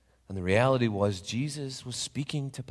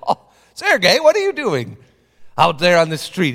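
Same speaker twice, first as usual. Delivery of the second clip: high, loud call through the window; 9 semitones higher, 13 dB louder than usual. Acted. Papa calling to Sergei in the street, high and loud. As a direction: call out in surprise.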